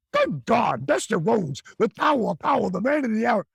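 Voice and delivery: fast shouted promo rant; wrestling promo. Retold or imitated. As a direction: fire off a pumped-up wrestling promo. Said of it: "jibber jabbers. I stole his shit".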